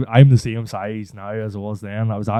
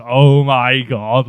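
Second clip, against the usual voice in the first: dread; 3 dB louder, much slower than usual. Retold or imitated. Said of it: "I goes".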